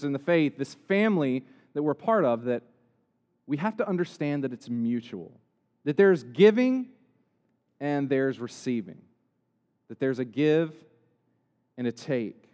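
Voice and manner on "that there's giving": giving punched loud and high; stress on giving. Acted. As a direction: hit the word giving hard. Punched word in sermon.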